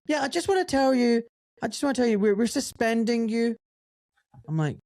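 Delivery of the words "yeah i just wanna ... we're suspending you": high-pitched mock phone voice; halting, raised-pitch official; imitated hospital director. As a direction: imitate an awkward boss breaking news, pitched way up. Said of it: "when the hospital director".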